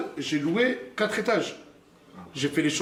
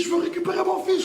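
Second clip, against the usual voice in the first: retold shouting; 11 semitones higher than usual. Father demanding his baby back, high desperate shout. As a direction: yell it, desperate to reach your son. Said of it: "je crie".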